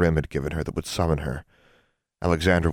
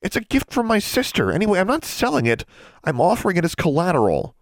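high, firm protest; heated insistence; 14 semitones higher, 3 dB louder than usual. A narrator's reading. Girl refusing to sell sister's mirror, heated.

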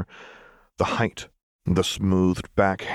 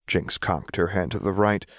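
usual speaking voice

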